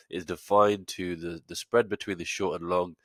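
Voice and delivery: usual speaking voice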